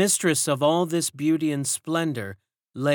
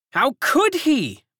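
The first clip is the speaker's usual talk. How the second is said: slow, high loud retort; interrupting servant; 14 semitones higher, 7 dB louder than usual. A narrator's reading. Servant breaks in on the gossip, high and loud. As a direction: cut in sharply. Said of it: "broke in another".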